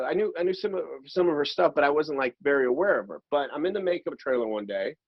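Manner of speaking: usual speaking voice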